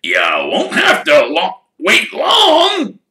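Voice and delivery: loud gruff voice, slow and pleased; Hagrid with a grin. Acted. Hagrid telling Harry the Weasleys are coming, gruff and grinning. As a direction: grin through it. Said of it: "said with a grin".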